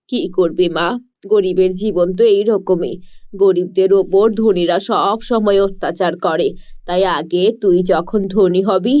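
a tired fisher parent explaining gently. Acted.

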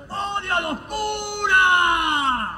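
put-on slow, loud possessed girl; creepy child.

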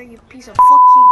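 piercing high scream; hailing a taxi. Pretend. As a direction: scream it at full blast. Yelling at the cab again.

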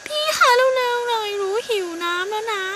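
put-on high, tearful little girl begging; whiny little girl. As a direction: plead like a thirsty small child. Little bird begs for water. (เสียงเด็กหญิงตัวเล็กงอแงขอน้ำ)

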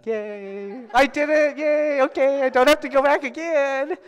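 put-on very high, loud childlike cheer; high-pitched victory cheer, stretched out; mock celebration. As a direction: squeal with hammed-up glee. Celebrates being done with evangelizing.

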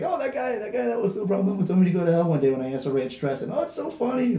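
high-pitched mock customer gushing; mimicked amused customers. Mimicking customers who find it funny.

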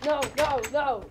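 panicked. Real, not acted. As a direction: cry out in rising alarm. Panics as monster approaches.

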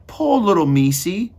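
high, loud cooing pity; pitying character voice. A narrator's reading. Meg pities the mouse in the oil, high-voiced. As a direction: coo over a little creature, high and loud.

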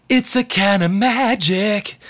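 feminine seventies tone. Retold or imitated. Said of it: "you wouldn't hear that tone early in the seventies".

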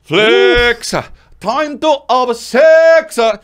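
slow Jamaican dancehall drawl; Jamaican accent. Retold or imitated. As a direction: draw it out in patois. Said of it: "very peanut butter falcon Rastafarian".

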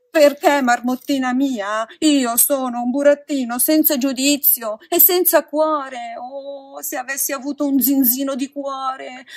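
loud, high-pitched wail of regret; theatrical self-reproach, pleading and loud; regretful lament. A narrator's reading. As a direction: pour out remorse at full voice. Pinocchio laments his heartlessness and mistakes.

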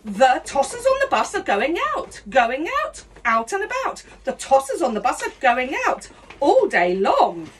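ordinary talk

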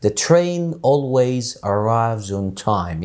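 The train always arrives on time said very slowly; slow demo. Shown as a demonstration. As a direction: spell out each word slowly. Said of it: "هقول لك". (نطق بطيء وواضح)

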